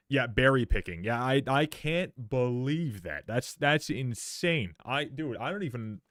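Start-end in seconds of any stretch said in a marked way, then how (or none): none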